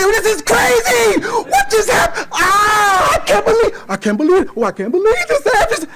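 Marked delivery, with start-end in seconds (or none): 0.0-1.2 high stretched-out scream. Retold or imitated. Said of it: "I'm screaming". 1.2-3.8 high, strained disbelieving scream; screaming in disbelief while driving home; retold screaming. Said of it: "I'm screaming". 4.6-5.9 high, strained disbelieving scream; screaming in disbelief while driving home; retold screaming. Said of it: "I'm screaming".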